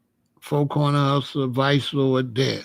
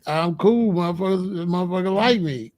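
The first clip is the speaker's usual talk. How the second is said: ordinary talk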